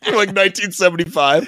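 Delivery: high, giggling through words; laughing. Real, not acted. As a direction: laugh while describing. Laughing about the shorts.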